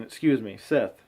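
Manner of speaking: normal talking voice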